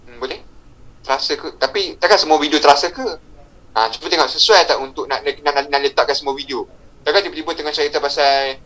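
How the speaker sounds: ordinary talk, nothing marked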